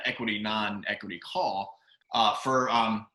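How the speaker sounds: ordinary delivery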